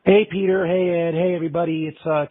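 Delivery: ordinary delivery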